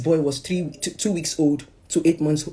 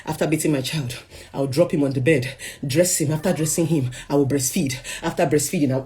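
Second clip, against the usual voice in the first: ordinary delivery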